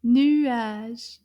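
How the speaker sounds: nuage loud, nu-age split, rising end; two syllables. Shown as a demonstration. Teacher showing the two syllables of nuage, loud. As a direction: sound out both syllables of nuage. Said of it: "Deux syllabes".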